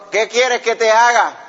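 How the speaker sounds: the Lord asking the leper. Acted. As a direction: ask it slowly and solemnly. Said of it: "le dice el Señor".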